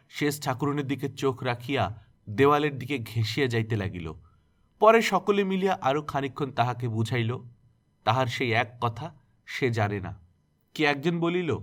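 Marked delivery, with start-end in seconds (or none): none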